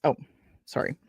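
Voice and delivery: ordinary delivery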